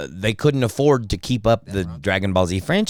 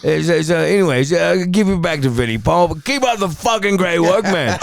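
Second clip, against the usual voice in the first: rambling British rock star, pitched up; 6 semitones higher, 3 dB louder than usual. Acted. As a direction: meander and stammer through praise like an old rocker. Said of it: "Act like [name]".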